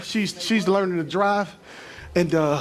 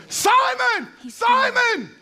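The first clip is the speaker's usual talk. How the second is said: screaming name; 13 semitones higher, much slower than usual. Retold or imitated. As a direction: scream the name across the room to stop him. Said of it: "Jesus literally screams".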